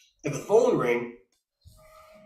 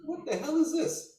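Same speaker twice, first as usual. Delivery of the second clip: high, hushed disbelief; replayed shocked reaction, high and soft; retold shock; 11 semitones higher, 7 dB quieter than usual. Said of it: "just sitting there shocked".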